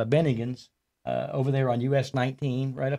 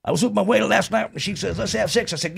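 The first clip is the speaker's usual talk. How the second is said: imitating Rusty; 8 semitones higher than usual. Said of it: "He, he, he's like".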